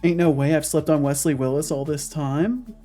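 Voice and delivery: ordinary delivery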